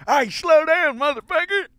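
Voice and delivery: high, loud road-rage yell; mock yell. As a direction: yell it at the crazy driver. Driver yelling at road-rage vet.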